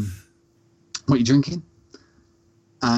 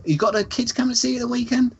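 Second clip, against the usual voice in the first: put-on much higher, quick chirpy old lady question; imitating Nan Peggy; 12 semitones higher, faster than usual. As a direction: ask brightly like a nosy nan.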